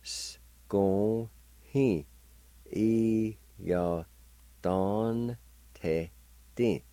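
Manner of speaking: scohe e ya don te ti said together; full Cherokee word scohe e ya don te ti; whole word. Shown as a demonstration. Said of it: "And together".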